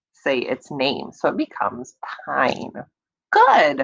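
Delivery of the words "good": "Good" said much higher and louder; bright praise. Real, not acted. Teacher praising the class: excited, high.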